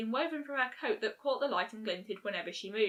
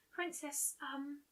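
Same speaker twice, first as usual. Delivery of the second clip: much softer hesitant start; 4 semitones higher, 13 dB quieter, much slower than usual. A narrator's reading. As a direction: trail off shyly. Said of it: "Fluttershy hesitated".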